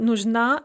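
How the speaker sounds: нужна stressed on the final на; stress on second syllable. Shown as a demonstration. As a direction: land the weight on на in нужна. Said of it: "is stressed on the second syllable".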